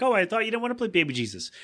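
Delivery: fast, high surprised question; puzzled sister; lightly voiced Meg; mock Meg.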